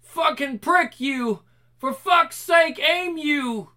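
high, loud enraged yelling; furious shouting. Acted. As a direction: spit it out in pure rage. Furious fighter in battle.

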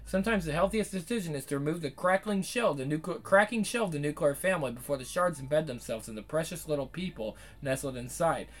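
quick, low, even read of a written essay; reading someone's article aloud to viewers. Acted. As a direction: read the piece through at a steady clip.

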